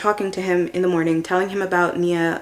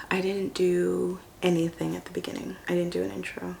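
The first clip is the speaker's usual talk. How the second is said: softer, hushed afterthought; whispered aside; 9 dB quieter than usual. Real, not acted. Admitting to viewers she forgot the intro, whispered.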